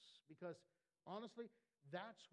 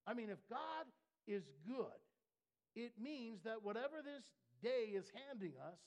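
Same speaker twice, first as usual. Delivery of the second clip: loud, climbing sermon peak, much higher on good; preaching at full force; 6 semitones higher, 7 dB louder than usual. Acted. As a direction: shout it out to the church.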